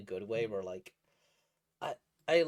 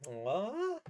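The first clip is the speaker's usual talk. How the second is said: touched aw; 9 semitones higher than usual. Retold or imitated. Touched by the written I love you, high aw. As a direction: coo at it fondly. Reacting to sweet scene.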